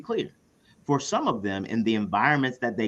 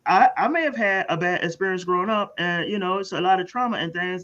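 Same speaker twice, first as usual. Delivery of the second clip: higher, earnest parent asking for help; worried parent voice; 8 semitones higher than usual. Retold or imitated. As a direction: play a struggling parent. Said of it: "the people who are like".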